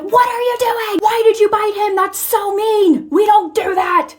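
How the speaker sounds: high-pitched mock yell, drawn out at the end; retold scolding yell. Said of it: "I immediately yell, start shaming her".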